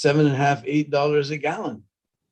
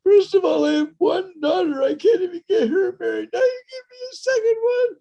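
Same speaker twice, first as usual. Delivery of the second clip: much higher, wailing and sobbing; crying voice, far higher, ending in a wail; crying Brahmin; 4 dB louder than usual. Acted. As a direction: blubber out his despair. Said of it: "that Brahmin really began to cry".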